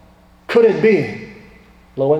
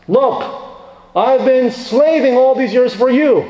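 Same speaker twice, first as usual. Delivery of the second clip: resentful son; 7 semitones higher than usual. Retold or imitated. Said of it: "not this".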